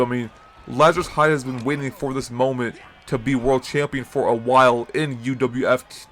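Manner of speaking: ordinary delivery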